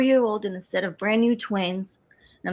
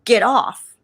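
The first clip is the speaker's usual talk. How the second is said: sharp, loud order to get off the train; commanding voice; 8 dB louder than usual. Retold or imitated. Mystery voice ordering her off the train, loud command. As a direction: bark it out like a voice from nowhere. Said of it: "and I hear a voice".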